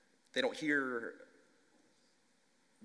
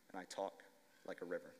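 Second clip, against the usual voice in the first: fading softly; 4 semitones lower, 11 dB quieter, faster than usual. A narrator's reading. Closing line of story.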